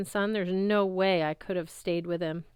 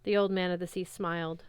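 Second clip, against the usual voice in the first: ordinary delivery, nothing marked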